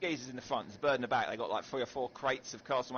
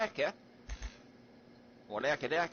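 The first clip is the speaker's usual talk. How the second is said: Australian accent; 4 dB quieter, faster than usual. Shown as a demonstration. Gamer quizzed on Australian name for a band, Australian accent. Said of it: "But in an Austra".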